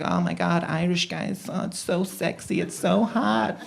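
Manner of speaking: soft, swooning American impression; American accent. Americans fawning over Irish guys, mock American accent. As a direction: gush quietly in a put-on American accent. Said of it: "the Americans aren't always like".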